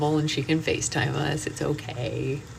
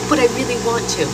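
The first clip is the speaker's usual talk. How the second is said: higher mock child plea, louder; whiny child; 7 semitones higher, 5 dB louder, faster than usual. Mother voicing her daughter pleading for dessert, whiny. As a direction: whine it like a kid who wants dessert.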